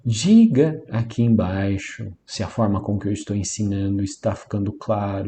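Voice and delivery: normal talking voice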